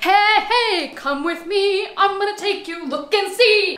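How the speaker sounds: high, loud, bubbly host voice inviting you along; cheery tour guide. Pretend. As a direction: play the overexcited plant tour host. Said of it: "So I wasn't like".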